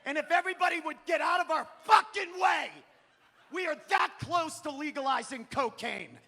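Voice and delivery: loud, high shouted boast; worked-up boasting. Acted. Comic defending Denver to his aunt, worked-up shouting.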